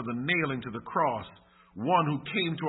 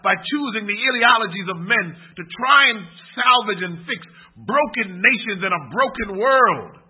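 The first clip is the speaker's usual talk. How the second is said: loud, higher-pitched sermon appeal; impassioned preaching; 7 semitones higher, 9 dB louder than usual. Real, not acted. Preacher urging church to choose Christ over Barabbas, loud and impassioned. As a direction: preach it out forcefully, raised in pitch.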